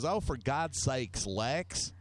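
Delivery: imitated Southern mom, mildly scolding; southern lady voice. Friend's mom scolding the drunk guest, imitated southern lady voice. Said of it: "In that southern lady voice".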